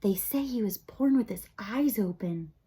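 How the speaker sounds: hushed unvoiced gossiping whisper; crowd whisper. A narrator's reading. Mice gossiping about Despero, whispering. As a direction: whisper it behind a paw. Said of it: "the mice whispered".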